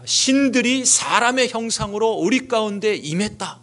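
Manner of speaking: retold crowd cry. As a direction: proclaim it like an astonished onlooker, mildly. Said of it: "소리를 지르면서 말했습니다". (군중의 외침을 높은 음으로 흉내 내듯)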